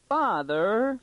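very high squeal, rising at the end; anguished squeak. A narrator's reading. Daughter caught out by her father, squeaking in anguish. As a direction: squeak it out in dismay. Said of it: "she squeaked in real anguish".

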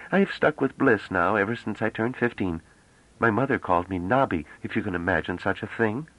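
put-on high, chatty young woman; lively young woman. Young woman makes light of long names, lively. Character voice of Bliss.

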